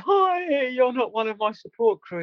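high, startled greeting to a stranger; startled squeak. Retold or imitated. Runner greets the old man passing him, startled and high-pitched. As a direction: yelp it out in surprise, then settle.

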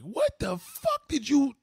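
loud, high, drawn-out outburst; outraged father. Retold or imitated. Imitating a friend's father's reaction, outraged father. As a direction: explode slowly, in disbelief, as the father. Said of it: "your father was like".

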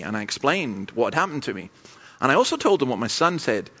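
ordinary talk

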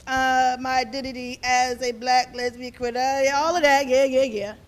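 level, run-on listing of labels; mock rote recital. Speaker mimicking a shallow answer about identity, mock rote recital. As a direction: rattle it off dismissively, as if by rote. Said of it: "And not just like this, like".

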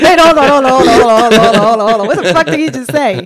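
loud, high retold reaction through laughter; laughing mock disbelief. Retelling a misheard drink name, laughing mock panic. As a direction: crack up while frantically halting someone.

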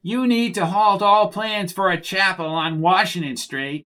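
high, strained acted shout at held-back volume; understated shouted rebuke; mock shout. A narrator's reading. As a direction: scold from the pulpit without full volume. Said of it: "shouted Gallagher, pointing his finger".

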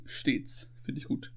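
breathy, voiceless whisper; whispered aside. Pretend. Savouring an old-fashioned word.

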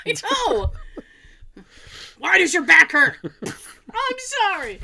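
high shrieking, slow hysterics; hysterical parody. Pretend. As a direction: play the hysterical passenger from Airplane. Continues hysterical passenger bit.

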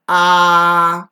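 Ah sung high and loud. Shown as a demonstration. Teacher shows a high tone so the sound goes up. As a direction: lift Ah up high. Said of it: "it's going up".

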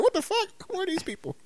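squeaky high disbelief, then a hushed question; shocked eavesdropper. Retold or imitated. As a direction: act startled at strangers on the line. Overhearing strangers on cordless phone.

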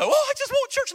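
exaggerated eager convert; mock eagerness. Pastor contrasting old enthusiasm, squealed high and loud. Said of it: "there's no".

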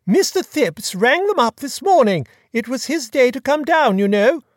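put-on chatty, high-pitched grand old lady; imperious plummy grande dame. A narrator's reading. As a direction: gossip like a fluttery duchess. Duchess tells the news.

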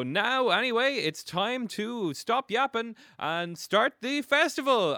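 loud, high, drawn-out announcer's call; mayor proclaiming. Acted. Game master as the mayor starting the festival, proclaiming. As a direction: build to a big festival kick-off. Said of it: "And they go".

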